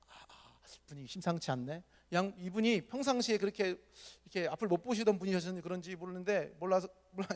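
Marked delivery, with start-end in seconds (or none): 0.1-1.8 whispered, nervous sensing; whispered aside. Retold or imitated. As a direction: mutter under your breath, uneasy. 맹인이 눈치 보는 속말. (숨죽여 속삭이듯 눈치 보는 말)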